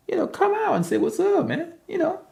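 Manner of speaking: mock greeting. Urging lurkers to greet.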